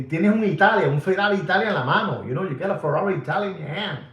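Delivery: fast, high, loud excited gush; excited exclamation. Retold or imitated. Imagining admiring a man's watch, fast excited gush. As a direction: rattle it off, thrilled. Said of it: "I go".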